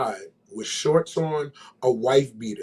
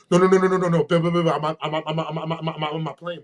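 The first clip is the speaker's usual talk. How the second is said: panicked insistence; 2 semitones higher than usual. Retold or imitated. Retold panicking friend insisting.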